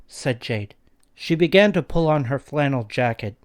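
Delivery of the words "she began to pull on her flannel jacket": ordinary delivery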